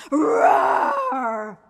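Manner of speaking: long, loud rough roar; lion's roar. Acted. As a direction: roar like a ferocious lion. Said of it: "The lion roared".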